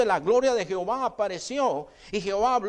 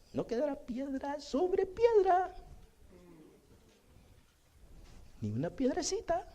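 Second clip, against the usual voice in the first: mocking the film's light, high, soft Jesus; breezy, singsong parody of a casual Jesus; playful, like a game; 10 semitones higher, 6 dB quieter than usual. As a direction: toss off a grave prophecy as if it were a game. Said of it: "O sea, como que es juego".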